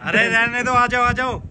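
high, loud calling out. Real, not acted. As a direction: call her back urgently. Calling someone back from the roof.

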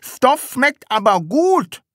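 high, loud, slow munching voice; mouth full. A narrator's reading. Sams praising the taste of cloth, mouth full. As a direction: talk round a big mouthful. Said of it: "sagte das Sams mit vollem Mund".

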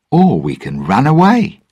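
high, cheerful lilt; bright. A narrator's reading. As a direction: offer it brightly, pitched well up. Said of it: "Jack answered brightly".

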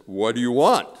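loud voice of God; big heavenly voice. Acted. God questioning the atheist, big loud voice. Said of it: "this big voice that came from the heavens".

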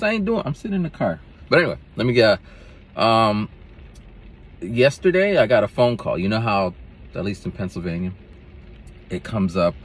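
normal talking voice

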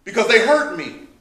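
loud, rising sermon voice, driving each point home; preaching at full force. Acted. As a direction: preach it to the saints with fire.

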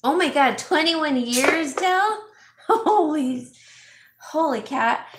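high, loud astonished exclaiming; amazed. Real, not acted. Host reacts to a cat living twenty-one years, amazed. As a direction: exclaim in disbelief, voice jumping up. Amazed at a cat's long life.

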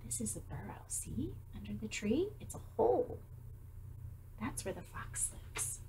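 soft whisper, like sharing a secret; teacher whispering where the fox lives. Real, not acted.

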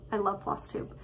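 ordinary talk

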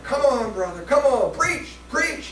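high excited call-and-response; church calling out. Retold or imitated. Congregation urging the preacher, high excited calls. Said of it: "this church was like".

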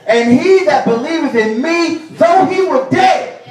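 high, loud King James proclamation; preaching shout. Acted. Preacher thundering Jesus' words to the church, loud and high. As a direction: ring it out to the congregation.

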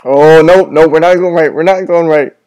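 backing off in real fright; panicked. Real, not acted. Hears a roar, panics.

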